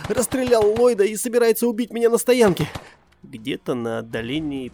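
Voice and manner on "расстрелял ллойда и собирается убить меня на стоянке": high loud frantic reporting; panicked report. Acted. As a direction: blurt it out in panic.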